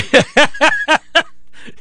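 high breathy burst of laughter; long, high-pitched real laugh. Real, not acted. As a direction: laugh hard, high and airy. Laughing at co-host's remark.

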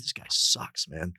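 hushed mocking dig at the newcomer; whispered jab. Players whispering that the new guy sucks, hushed and snide.

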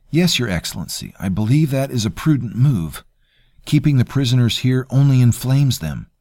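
a hint of a smug, polite cousin; smooth courtier. Voices courtier Terrell.

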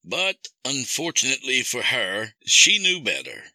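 high, cold mocking villain; menacing villain. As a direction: toy with him like a killer. Villain man speaks.

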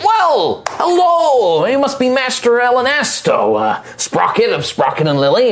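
high, courtly gnome voice; old gnome craftsman. Acted. Old gnome shipwright welcoming the client, high and courtly. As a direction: greet him grandly, high and pleased. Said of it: "they're like".

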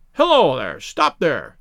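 gruff challenge, loud on the first word; restrained narrator's shout. A narrator's reading. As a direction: call out to halt someone. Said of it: "he shouted".

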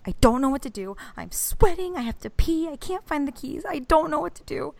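panicked whine. Retold or imitated. Wife panicking to husband on the phone, high whine. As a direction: sound frantic and helpless on the phone. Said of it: "and I'm like".

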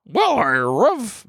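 high, drawn-out cartoon dog voice; slow, goofy talking-dog howl; Scooby-Doo. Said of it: "Just gonna do it in a Scooby-Doo voice".

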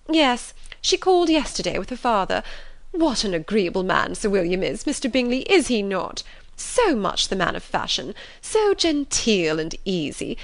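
animated, swooping mother voice; gushing, chatty mother. Acted. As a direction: gush admiringly about a neighbour. Mrs Bennet praises Sir William.

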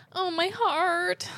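touched. Real, not acted. Reacting to friend's mom dying.

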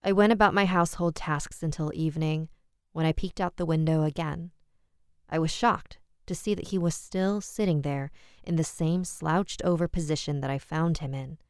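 ordinary delivery

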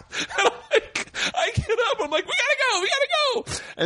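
very high, frantic comic plea; hammed-up urgency in a screechy pitch; mock panic. Begging beaten friends to leave, high mock-panicked cry.

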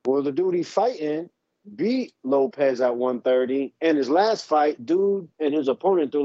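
ordinary talk

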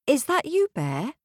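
put-on timid girl voice. A narrator's reading. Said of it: "asked the girl".

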